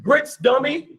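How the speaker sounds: high, lightly mocking sing-song quote; quoting Fiddler. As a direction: tease like a well-known line. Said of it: "like Fiddler said".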